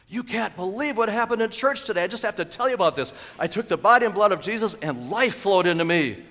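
high, loud, breathless-to-share news; elated, urgent telling; bursting with excitement. Acted. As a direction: gush to a friend about amazing news. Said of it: "so excited that you can't wait".

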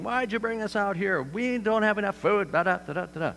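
high whiny complaining voice; mock whining. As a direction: whine like grumbling Israelites in the desert. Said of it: "Complaining and whining".

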